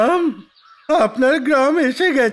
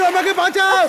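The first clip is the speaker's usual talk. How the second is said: calling for help; 6 semitones higher than usual. Acted. Man fallen in well, crying help.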